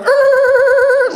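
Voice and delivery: very high, loud drawn-out hoon cry; bogan yell. Retold or imitated. Brothers doing donuts past the cafe, loud hoon cry. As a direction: let rip a wordless hoon yell. Yelling from car.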